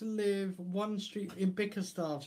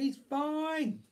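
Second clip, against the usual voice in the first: silly dog voice; 9 semitones higher than usual. Pretend. Voicing the dog.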